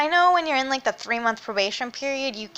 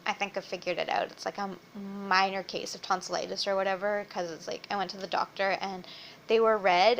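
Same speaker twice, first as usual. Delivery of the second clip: sore throat; 3 semitones lower, 6 dB quieter than usual. Real, not acted. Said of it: "be quiet as I can".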